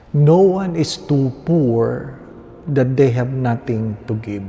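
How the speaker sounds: ordinary talk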